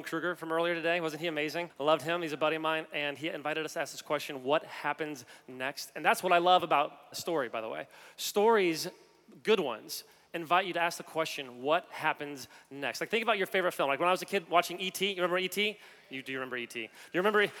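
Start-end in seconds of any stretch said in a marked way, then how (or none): 4.4-5.9 slow suspense. Acted. Slowly poses the story question. 11.6-13.0 slow suspense. Acted. Slowly poses the story question.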